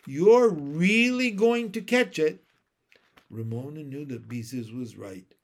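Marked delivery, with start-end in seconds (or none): 0.1-2.4 high, loud big-sister threat; angry, emphatic scolding; storming. A narrator's reading. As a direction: warn of trouble with real force. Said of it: "stormed Beezus".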